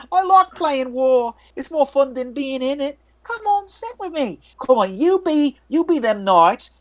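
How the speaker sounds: put-on high childlike voice, eager to play; a strange boy inviting you to play war; creepy little boy. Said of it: "and he's like".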